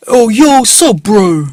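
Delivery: high, loud hailing call; a random guy shouting a greeting at a stranger; loud street stranger. Retold or imitated. Stranger hails him on Broadway.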